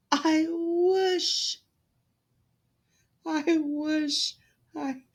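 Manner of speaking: put-on a girl too choked with tears to finish; crying. A narrator's reading. Cinderella crying to her godmother, high and tearful. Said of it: "she was crying so hard".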